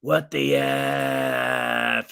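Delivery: exasperated, very slow, F held on and on; long-held word. Retold or imitated. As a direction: drag out the F in "What the F?". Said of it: "he was just, like, sitting there holding it".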